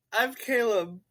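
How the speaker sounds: high, giggly intro; laughing. Real, not acted. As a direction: say your name mid-giggle. Laughing through intro attempt.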